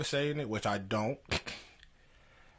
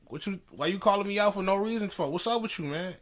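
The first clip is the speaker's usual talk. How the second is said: higher, heated demand for an answer; retold angry demand; 7 semitones higher than usual. Calling the grad assistant out in rehearsal, heated demand. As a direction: confront him in front of the band. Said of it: "I was so heated".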